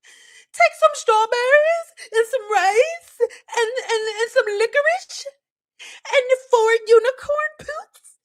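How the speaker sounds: high put-on voice, laughing as she rattles off a silly recipe; mock silly follower. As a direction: ridicule the DIY recipe crowd, laughing through it. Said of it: "Now we like".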